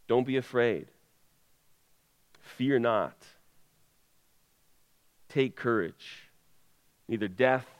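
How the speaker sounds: low, soft sermon close with long pauses; hushed solemn preaching. Real, not acted. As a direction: let each charge settle quietly on the congregation.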